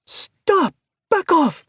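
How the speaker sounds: very high, piercing scream; terrified shriek. Acted. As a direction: scream it in panic from far away. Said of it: "A shriek broke the silence".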